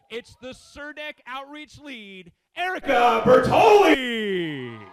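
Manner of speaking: slow build to a huge shouted name; announcer intro. Real, not acted. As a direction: stretch the name like a ring announcer.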